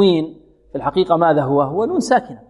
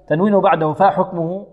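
normal talking voice

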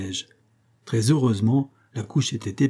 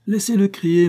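defiant shout; 9 semitones higher, 5 dB louder than usual. Acted. Corporal brushes off the lieutenant.

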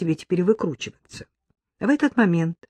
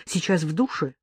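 ordinary delivery

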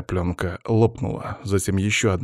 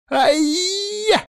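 very high-pitched yelp of pain; pained cry. A narrator's reading. Guard's partner burning his hand, pained cry. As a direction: yelp as if suddenly burnt. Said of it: "вскрикнул Лёха".

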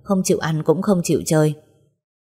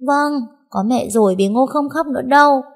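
put-on a small child bursting into tears at seeing mom; tearful little girl calling to her mother; little girl crying out; 9 semitones higher than usual.

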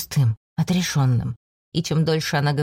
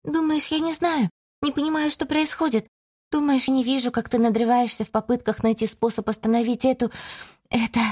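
put-on agitated young woman; 7 semitones higher than usual. A narrator's reading. Sister confronts brother.

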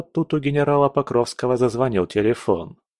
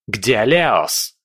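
angry prince barking for Leos; barked question. A narrator's reading. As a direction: snap the question at him. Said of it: "рявкнул князь".

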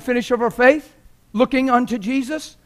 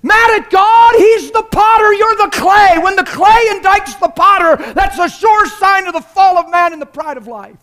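shouting at them; 7 semitones higher, 9 dB louder than usual. Retold or imitated. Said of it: "And I'm like".

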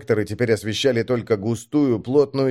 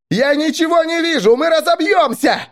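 very high, loud, terrified cry; panicked scream; 5 dB louder than usual. A narrator's reading. Blinded driver afraid of crashing, panicked scream. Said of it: "закричал Грач, срываясь".

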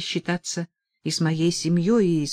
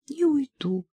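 a mild sob on a higher pitch; sobbing; 8 semitones higher than usual. A narrator's reading. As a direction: give a light tearful sob. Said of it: "всхлипнула Дженни". (с лёгким всхлипом, выше)

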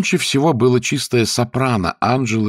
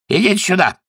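high, urgent summons; calling out; 11 semitones higher, faster than usual. A narrator's reading. Lord summoning butler from window, high urgent call. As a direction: call him over in a raised pitch. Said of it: "вскричал лорд Эмсворт".

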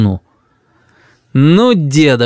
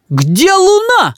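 very high, drawn-out furious demand; angry child's cry; much slower than usual. A narrator's reading. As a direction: demand it like an enraged little boy. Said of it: "с перекошенным от гнева лицом крикнул Рис".

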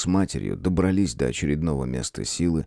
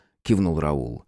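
ordinary delivery, nothing marked

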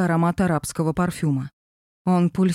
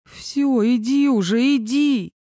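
impatient shooing; 6 semitones higher, slower than usual. Acted. Arkasha shooing Zhenya away.

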